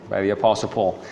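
ordinary talk, nothing marked

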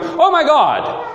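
high-pitched, drawn-out mock shock; shocked exclamation. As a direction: blurt it out as a stunned exclamation. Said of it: "like he's shocked and he's going".

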